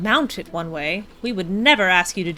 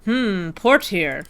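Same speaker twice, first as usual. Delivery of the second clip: usual speaking voice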